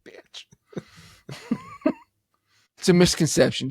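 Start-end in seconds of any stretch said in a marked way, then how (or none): 0.1-0.5 whispered under the breath with a laugh; muttered curse. Real, not acted. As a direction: swear to yourself, barely audible. Grudgingly admits he was wrong.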